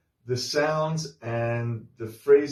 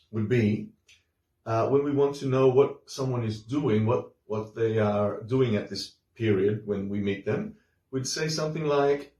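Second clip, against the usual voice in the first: ordinary talk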